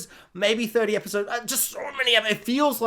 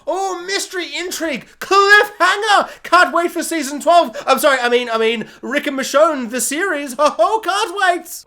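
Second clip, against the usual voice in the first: much higher, giddy excitement; mock gushing; 9 semitones higher, 6 dB louder than usual. Real, not acted. Vlogger gushes over the finale cliffhanger, much higher and giddy. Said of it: "gushing about the cliffhanger".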